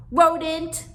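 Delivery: high, loud parting yell; yelling sister. Acted. Sister yelling back at brother from the stairs, high and loud. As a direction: hurl the insult as she leaves. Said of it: "she yelled back".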